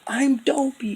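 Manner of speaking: very high put-on voice, imitating Dopey. Imitating Dopey introducing himself, very high put-on voice. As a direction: squeak it out as the dazed friend. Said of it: "he's like".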